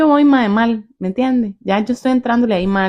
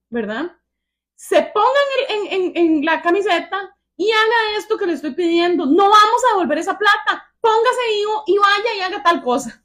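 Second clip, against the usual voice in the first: raised voice on the phone; 13 semitones higher than usual. Retold or imitated. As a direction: rant at him to get moving, pitched way up. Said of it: "le levanté la voz en el teléfono".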